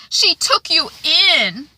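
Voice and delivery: high, loud, slow and wounded; mock girlish reproach; Lucy quote. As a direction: pitch it up and drag it out like a hurt girl. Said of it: "Lucy is like".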